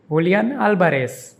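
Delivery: Julian Alvarez as hoo-lee-AHN AL-ba-res in one breath; Spanish pronunciation. Shown as a demonstration. Teacher says the full name smoothly, the Spanish way. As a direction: Julian with h and last-syllable stress, Alvarez with b and s. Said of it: "is pronounced".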